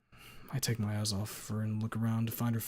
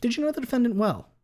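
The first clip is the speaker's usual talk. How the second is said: higher, louder lawyer question; 13 semitones higher, 7 dB louder than usual. Acted. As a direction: press the witness.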